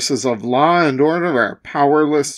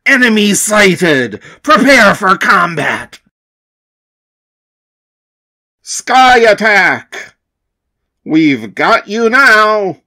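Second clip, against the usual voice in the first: louder, higher battle shouts; battle cries; 3 semitones higher, 6 dB louder than usual. Acted. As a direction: shout the attack orders. Rangers attack monster.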